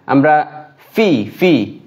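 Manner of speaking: ফি with a labiodental f; teeth-on-lip f. Shown as a demonstration. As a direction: say ফি as a clear f, not p. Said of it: "সামনের দাঁত নিচের ঠোঁটের পেটের সঙ্গে লাগবে".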